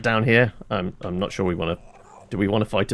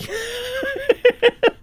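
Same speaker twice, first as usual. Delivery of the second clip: high laugh. Real, not acted. After getting killed in game.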